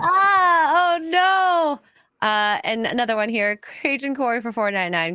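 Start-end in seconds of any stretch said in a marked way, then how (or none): 0.0-1.8 high, loud laughing "oh no"; mock dismay. Real, not acted. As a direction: groan in playful dismay.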